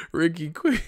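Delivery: ordinary talk, nothing marked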